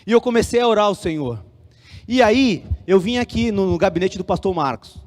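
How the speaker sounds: usual speaking voice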